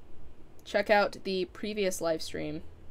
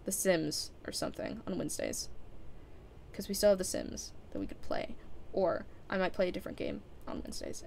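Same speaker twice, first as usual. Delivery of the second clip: talking quietly; 9 dB quieter, faster than usual. Real, not acted. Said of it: "I'm just talking quietly".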